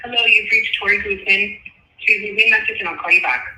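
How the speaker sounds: ordinary delivery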